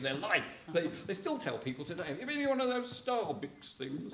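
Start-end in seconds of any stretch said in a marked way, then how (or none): 2.1-4.1 mock old folk. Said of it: "they don't talk like that".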